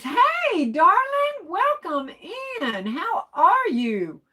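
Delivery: very high, slow, sing-song welcome; sweet greeter voice. Acted. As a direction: greet her like a doting hostess, way up high. Reading Basket Case's greeting aloud.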